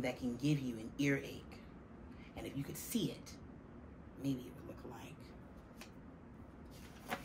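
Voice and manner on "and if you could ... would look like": hushed secret whisper to the listener. A narrator's reading. Narrator whispering to young listeners about germs. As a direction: breathe it like a secret.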